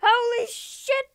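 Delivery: high, loud, slow cry of amazement; amazed shout. Real, not acted. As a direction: gasp it out at the discovery. Stumbles on a village.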